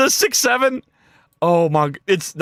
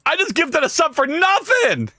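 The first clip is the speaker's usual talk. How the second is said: wailing complaint; 11 semitones higher than usual. Real, not acted. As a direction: cry out the loss, climbing higher to the end. Laments a wasted gift, too late.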